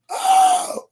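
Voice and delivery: sudden high-pitched cry of pain; high wail. Retold or imitated. Prisoner hears a scream from the cell, high anguished cry. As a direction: yelp it out high and sharp. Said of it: "all I hear is".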